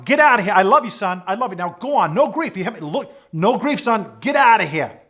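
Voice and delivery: imitation of a dad ordering his son off; emphatic dad. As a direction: drum it into your son, loud and insistent. Dad's loud send-off to son.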